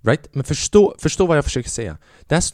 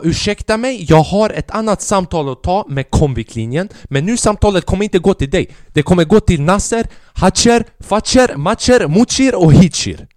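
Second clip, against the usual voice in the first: high, loud indignant rant; acted outburst, voice climbing higher; raised tone; 6 semitones higher, 5 dB louder than usual. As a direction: pitch it up and build to loud name-calling. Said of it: "min ton gick upp några oktaver".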